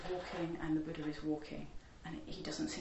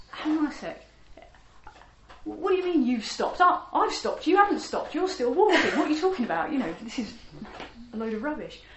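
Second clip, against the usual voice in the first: much higher and louder, protesting and incredulous; indignant disbelief; 6 semitones higher, 11 dB louder than usual. Acted. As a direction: scoff at the monk's claim in outrage. Bandit baffled, arguing back.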